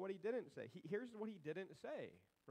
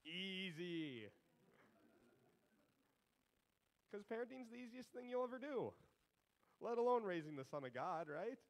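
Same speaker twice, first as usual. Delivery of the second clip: dry sarcastic easy; mock it as effortless; deadpan sarcasm about parenting; 4 semitones higher than usual. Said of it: "That was sarcasm".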